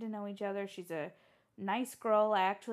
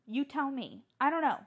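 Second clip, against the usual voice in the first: normal talking voice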